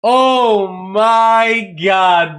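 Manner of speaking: high, slow, near-crying release; overwhelmed cry. Real, not acted. Streamer still overcome by the goal, crying out. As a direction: let it crack with joy.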